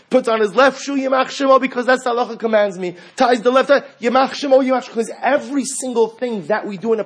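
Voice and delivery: high, loud and fervent; excited. Real, not acted. Preacher excitedly acts out each shoe. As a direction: build the story to its peak.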